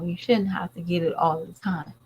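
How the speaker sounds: usual speaking voice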